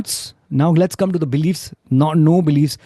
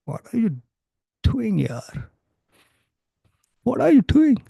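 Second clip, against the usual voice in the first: sad; 7 semitones higher than usual. Shown as a demonstration. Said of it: "if you say it without sadness".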